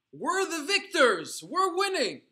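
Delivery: high, loud, slow triumphant cry. Retold or imitated. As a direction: cry it out in defiance from the gallows. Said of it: "I cried out in Yiddish".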